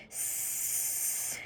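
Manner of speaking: long voiceless hiss. Acted. Rats hissing disapproval at Roscuro, long hiss. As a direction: hiss like a snake. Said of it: "hissed the other rats".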